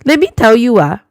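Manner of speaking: usual speaking voice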